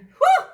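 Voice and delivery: a very high, loud whew of relief; relieved whew. Acted. Dog relieved after the dragon flies off, a loud whew. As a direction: blow out the danger. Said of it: "said the dog".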